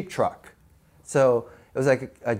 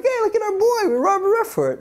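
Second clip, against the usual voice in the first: much higher, louder giddy brag; giddy boast; 4 dB louder than usual. Retold or imitated. The cast bragging about their friend's success, very high giddy voice. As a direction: crow about it like you're on top of the world.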